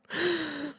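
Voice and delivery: quick, soft, high gasp. Real, not acted. Mock shocked by Shannon's comment, soft gasp.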